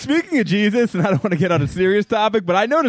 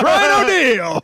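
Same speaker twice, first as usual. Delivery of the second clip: TV host voice; 6 semitones higher, much slower than usual. Pretend. Mock show intro, host name.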